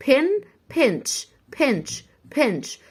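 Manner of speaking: "بنش" built up slowly; sounding out. Shown as a demonstration. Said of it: "هننطقها إيه".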